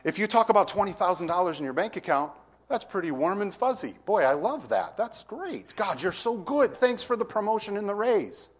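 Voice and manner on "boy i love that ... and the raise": delighted gratitude. Acted. Voicing a delighted believer.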